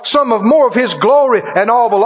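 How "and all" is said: usual speaking voice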